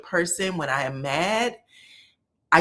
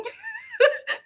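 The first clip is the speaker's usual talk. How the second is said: shrieking, squealing laugh, much higher. Real, not acted. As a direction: burst into a high squeal of laughter. Reacting to Shamea's gaslighting question.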